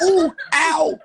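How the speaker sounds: very high wailing yelps; hammed-up whimpers of a beaten fighter; mock crying in pain. Tyson telling how the man he hit cried, mock crying in pain. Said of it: "He was crying".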